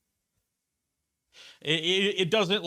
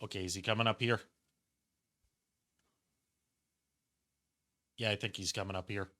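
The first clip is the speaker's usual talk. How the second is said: whispering while hiding; 5 semitones lower, 11 dB quieter, faster than usual. Real, not acted. Sneaking past a guard in game.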